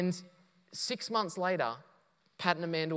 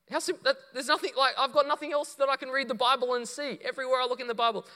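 much higher, fast and louder; rapid, high, eager rush of words; excited outburst; 10 semitones higher, 4 dB louder, faster than usual. Real, not acted. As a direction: burst out with delight at how simple it is.

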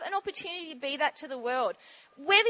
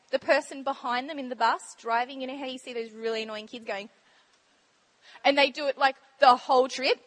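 normal talking voice

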